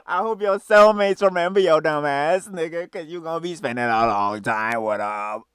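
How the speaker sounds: loud, high, drawn-out furious yelling with a laugh. Real, not acted. Streamer yells at the man on screen, furious and loud. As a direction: yell it at him like he is going straight back.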